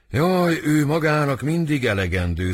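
stagey outburst of heartache; dramatic lament. Acted. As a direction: lament aloud like a suffering lover. Romeo laments love in read play.

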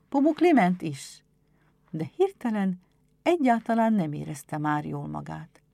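plain reading voice

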